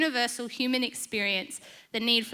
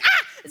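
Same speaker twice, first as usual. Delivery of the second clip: short, high, loud acted yelp; alarmed yelp; 8 dB louder than usual. Husband notices car rolling backwards, alarmed mock yelp. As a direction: imitate a startled husband crying out.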